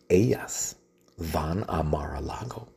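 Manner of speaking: conspiratorial whisper. Acted. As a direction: whisper it like a secret plan. Secret plot told in whisper. (susurro conspirador)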